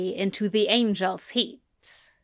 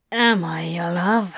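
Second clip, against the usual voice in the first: slightly lowered, intent question; low voice; 3 semitones lower than usual. A narrator's reading. Crowley to lover, asking in low voice. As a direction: ask it low and pointedly. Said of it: "he asks in a low voice".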